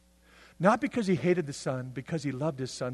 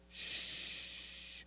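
a soft unvoiced shh; whispered shush; 14 dB quieter than usual. German soldier hushing his dying victim in a whisper. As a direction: hush him as the knife goes in.

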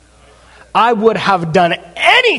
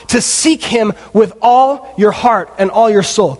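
usual speaking voice

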